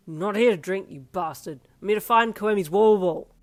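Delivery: loud annoyed retort; angry. Acted. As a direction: snap back loudly. Angry reply to drunk teammates.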